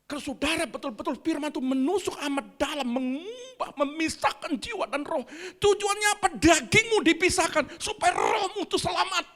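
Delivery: rising sermon fervour with a laugh at the end; fervent preaching. Acted. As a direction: drive the point home from the pulpit. Preaching rises to full force.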